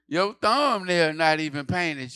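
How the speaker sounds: mock outrage; sassy roast. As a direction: drag her for it. Roasting the attendant.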